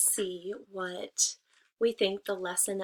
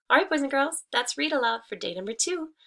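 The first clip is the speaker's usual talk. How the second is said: higher, louder cheerful goodbye; cheery sign-off; 5 semitones higher, 4 dB louder, faster than usual. Acted. Teacher ending read aloud for her class, cheerful. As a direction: wave the kids off brightly.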